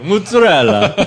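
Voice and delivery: high, loud Italian-style mozzarella; hammed-up Italian pronunciation, loud; Giada. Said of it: "I'm not like Giada".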